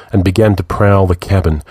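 usual speaking voice

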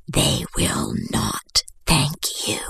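slow ominous whisper; whispered warning. Acted. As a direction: breathe it as a dark warning.

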